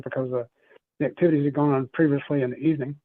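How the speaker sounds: usual speaking voice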